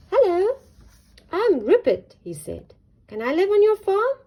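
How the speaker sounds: high, loud eager bunny; eager rabbit. Acted. Rupert asks to live on farm, high eager. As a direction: pipe it up brightly.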